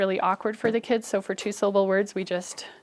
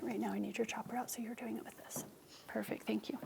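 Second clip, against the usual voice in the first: hushed aside; 18 dB quieter, faster than usual. Real, not acted. Quiet aside to child.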